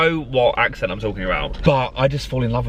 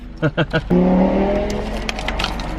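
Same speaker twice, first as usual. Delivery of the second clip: long, high laugh; 7 semitones higher than usual. Real, not acted. Joking with the server at the coffee window, high drawn-out laugh.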